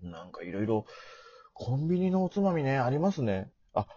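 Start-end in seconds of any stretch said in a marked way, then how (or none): none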